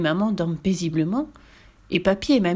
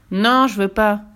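loud stubborn no; boy refusing the witch; refusing child; 6 dB louder, slower than usual. Acted. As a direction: refuse outright.

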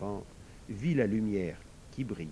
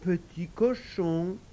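slow, higher-pitched questioning echo; lightly voiced child, higher; 7 semitones higher, much slower than usual. A narrator's reading. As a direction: echo it as a puzzled little girl, drawn out. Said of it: "répéta Rachida".

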